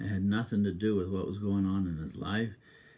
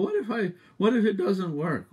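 higher, louder doubting voice; doubter's question; 12 semitones higher, 6 dB louder than usual. Retold or imitated. Preacher voicing a doubting believer's worry. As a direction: voice the worried listener.

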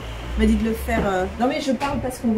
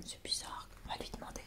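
whispering; 2 semitones higher, 12 dB quieter than usual. Real, not acted. Whispering to the baby.

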